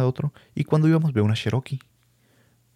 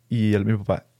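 ordinary talk

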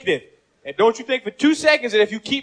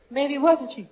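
doubtful softer tone; 6 dB quieter than usual. Retold or imitated. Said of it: "You know what the woman said?".

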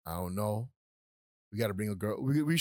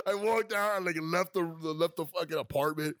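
ordinary delivery

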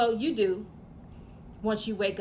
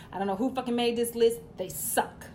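normal talking voice

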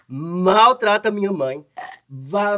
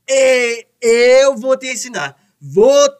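louder, higher threat; angry threat; 3 semitones higher, 5 dB louder than usual. Acted. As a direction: shout the warning. Sabino threatens the brother-in-law.

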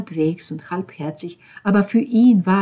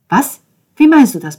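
loud, high, startled retort; sharp indignant demand; indignant challenge; 7 semitones higher, 9 dB louder than usual. Acted. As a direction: fire the question back at him. Ben whirls round and challenges.

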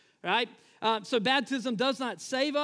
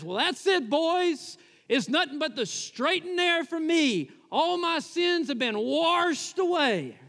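higher-pitched movie convert crowing to his buddies; Delmar; 5 semitones higher than usual. Retold or imitated. Newly baptized man telling his boys he's saved, elated mock proclamation. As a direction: proclaim it high, stretching the last words out.

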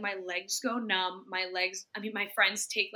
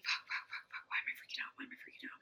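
breathless frantic whisper under the breath; whispered inner freak-out at a concert; panicked whisper; 13 dB quieter, much faster than usual. Retold or imitated. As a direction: hiss it to yourself in rising panic. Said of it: "I'm like".

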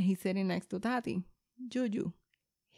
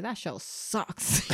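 slow, dismissive drawl; sulky dismissal; much slower than usual. Retold or imitated. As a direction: drag it out like an annoyed kid. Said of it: "she's like".

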